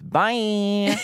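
high, stretched farewell; catchphrase sign-off on a held note; imitating English queens. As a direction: quote their signature goodbye, held long. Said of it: "and end with".

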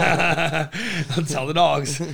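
slow words through a laugh. Real, not acted. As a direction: laugh while saying it. Laughing at own joke.

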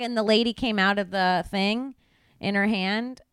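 ordinary talk

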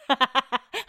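a sudden high laugh she can't hold back; real burst of laughter. Real, not acted. Trying to keep a straight face on the call, real laugh. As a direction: crack up, fighting a straight face. Brett's look breaks her composure.

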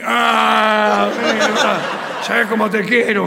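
loud, laughing reply as the father; laughing mock shout. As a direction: bellow it through laughter. (el padre gritando entre risas)

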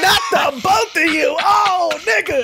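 high, loud comic wail; mock disbelief. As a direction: cry out in exaggerated dismay. Mock dismay at second impediment.

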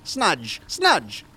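bossy eccentric doctor; mad scientist voice. Acted. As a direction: order the lackey around grandly. West orders his lackey.

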